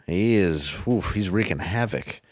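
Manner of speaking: usual speaking voice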